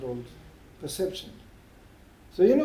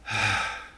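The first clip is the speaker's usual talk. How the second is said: long, low exhaled sigh; imitated heavy sigh; deep sigh; 8 semitones lower than usual. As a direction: let out a big sigh. Retells his godbrother's feeling while chanting.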